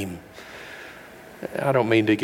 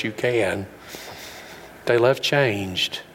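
normal talking voice

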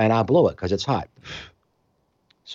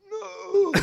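strangled high squeak of a no; choked no; 3 dB quieter than usual. Retold or imitated. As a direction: squeeze out a no while choking. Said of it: "And I'm like".

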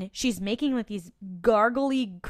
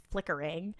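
usual speaking voice

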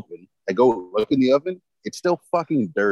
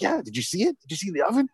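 proud as a peacock; 8 semitones higher, faster than usual. Retold or imitated. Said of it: "proud as a fucking peacock".

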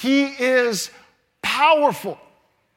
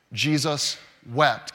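slow and deliberate; 8 semitones lower, slower than usual. Shown as a demonstration. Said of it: "Are you ready?".

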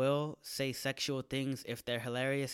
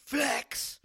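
a much higher squeezed "Flex"; strained high flex; 13 semitones higher, 5 dB louder than usual. Shown as a demonstration. Host demonstrating the Kegel squeeze, a strained high "Flex". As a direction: push "Flex" out high and tight. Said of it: "You just go".

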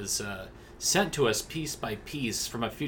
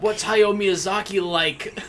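slow, loud, eager question; 5 semitones higher, 7 dB louder, slower than usual. Acted. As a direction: ask like a starstruck fan.

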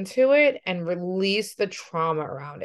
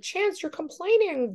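much higher, put-on nagging mom voice; mock scolding mom; 12 semitones higher, 4 dB quieter than usual. Mom mocking how she'd scold her son, high put-on nag. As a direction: exaggerate a nagging parent for a laugh. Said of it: "If I'm like".

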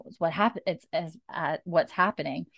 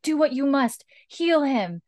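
higher, urgent command; pleading order; 8 semitones higher, 3 dB louder than usual. Retold or imitated. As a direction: insist she heal him. Jamie pleading.

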